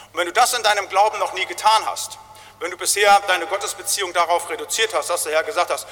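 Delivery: ordinary talk, nothing marked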